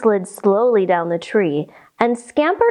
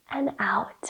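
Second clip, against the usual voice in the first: soft breath out; 11 dB quieter than usual. Shown as a demonstration. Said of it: "And one more time".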